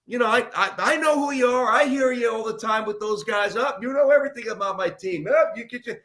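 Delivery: high, agitated imitated rant; pissed-off coach. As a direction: act out a riled coach sputtering at a critic. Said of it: "Now he's pissed".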